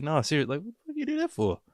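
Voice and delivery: high, fast squeaky what-the-heck; jokey high-pitched demand to a friend; mock exasperated call-out. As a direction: toss it off in a cartoonish squeal at a friend. Acted confrontation with friend.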